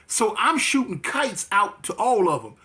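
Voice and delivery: ordinary talk, nothing marked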